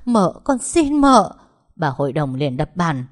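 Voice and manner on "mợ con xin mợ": high, loud outburst; frantic interruption. A narrator's reading. Mợ hai cutting off the servant's confession, frantic. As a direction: cut in sharply, voice raised high. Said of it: "liền chen ngang vào lời Tâm nói".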